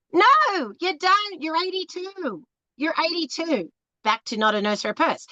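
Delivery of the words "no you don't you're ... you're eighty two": scornful callout. Real, not acted. Calling out lying older men.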